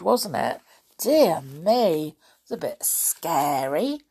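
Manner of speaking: usual speaking voice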